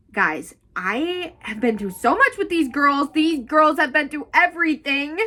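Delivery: high, loud, emotional outburst; fighting back tears. Real, not acted. Reader telling viewers what these girls endured, choked up. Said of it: "I'm trying not to".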